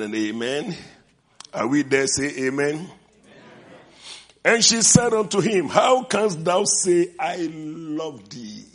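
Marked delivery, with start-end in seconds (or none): 4.4-8.6 loud, slow preacher's reading; dramatic scripture reading. Acted. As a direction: proclaim the verse with force.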